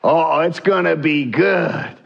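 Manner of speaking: gloating Korah, pitched a little up; smug rebel leader gloating to himself; smug scheming. Retold or imitated. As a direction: savor the trap he has planned. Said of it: "Korah's attitude right now".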